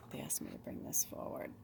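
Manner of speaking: unvoiced side remark; whispered aside. Real, not acted. Host whispering an aside about spirit requests, unvoiced. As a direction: murmur it off to the side.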